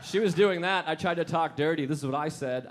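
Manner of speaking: normal talking voice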